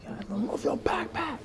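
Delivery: very high mock yell, kept soft; high-pitched, held-back mock shout; retold yell. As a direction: imitate someone yelling without full volume. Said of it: "they just yell at him".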